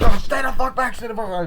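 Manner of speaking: high, loud mock yell; panicked warning. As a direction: imitate frantically warning someone off. Acted warning to stay back.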